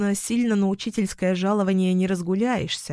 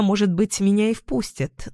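ordinary delivery

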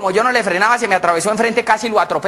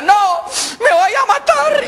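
high, slow mock wail; hammed-up despair; 13 semitones higher, much slower than usual. Jilted, fired man giving up, wailing comically. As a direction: wail dramatically, stretching the words.

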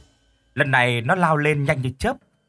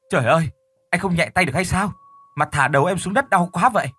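crying out in pain; 5 semitones higher, faster than usual. Acted. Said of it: "đau quá la lên".

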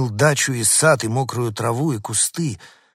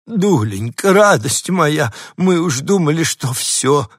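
high, loud gushing over a pet; lady clutching the dog, gushing with relief; doting lady; 6 semitones higher, 7 dB louder, slower than usual. A narrator's reading. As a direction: coo over him like a lost child. Lady cooing over found dog.

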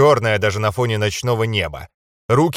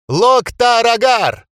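long, high, loud war cry; battle cry; 10 semitones higher, 5 dB louder, much slower than usual. A narrator's reading. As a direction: roar it charging into battle. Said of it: "кричали девчата".

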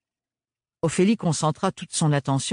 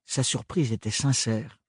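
plain reading voice

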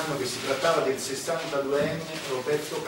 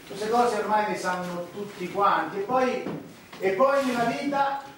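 higher, louder insistent lecture; heated; 7 semitones higher, 3 dB louder than usual. Acted. Lecturing the priest about tears, heated rising voice. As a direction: press the point, rising up.

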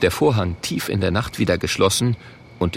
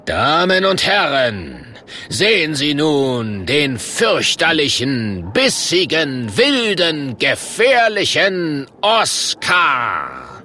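slow, booming fairground barker; showman's call; 5 semitones higher, slower than usual. Acted. The Murk presenting Oskar to the crowd, drawn-out showman call. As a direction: stretch every adjective, building to the name. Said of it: "tritt hinaus vor die Zuschauer und ruft".

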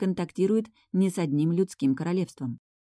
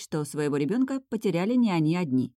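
plain reading voice

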